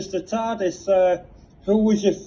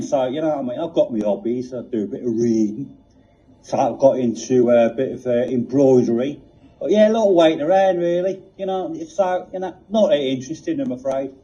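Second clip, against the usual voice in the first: lower, weary Cockney police box; Cockney accent; 4 semitones lower than usual. Acted. The TARDIS answering Reg, in a world-weary Cockney accent. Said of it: "Cockney accent".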